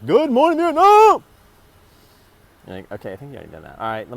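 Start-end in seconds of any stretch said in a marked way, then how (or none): 0.0-1.2 much higher, much louder radio-host bellow; Robin Williams. Retold or imitated. Vlogger booming a famous radio greeting in tribute. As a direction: belt it out like a manic DJ on air. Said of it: "long live Robin Williams".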